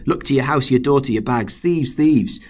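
loud, rising alarm call; shouting up to the father's window in mock panic; panicked call. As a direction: raise the alarm about thieves. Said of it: "trying to incite panic".

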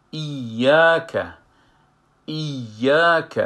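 slow recitation of ایاک from al-Fatiha; doubled ya demo. Shown as a demonstration. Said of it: "در سر یای ایاک علامه شده".

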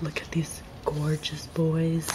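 slow, soft adoring whisper; swooning whisper. Real, not acted. Fan swooning over Big Bang cards, slow whisper. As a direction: breathe it out in slow adoration.

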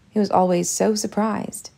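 ordinary delivery

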